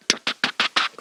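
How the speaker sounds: soft unvoiced tuk tuk like knuckles on a door; knocking noise. Telling friend about midnight knock, whispered knocking sounds. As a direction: tap out the knock with your mouth.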